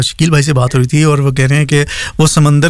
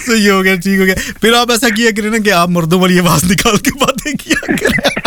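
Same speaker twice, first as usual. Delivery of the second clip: talking through laughter, higher; laughing; 5 semitones higher than usual. Real, not acted. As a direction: tease him while still laughing. Host teasing while laughing. (ہنستے ہوئے بات)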